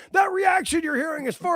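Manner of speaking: high-pitched commentator's hype call; Shivani impression. Podcaster re-enacts commentator over a silent crowd, high mock hype. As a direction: pitch it up like an excited announcer. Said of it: "like Shivani pointing".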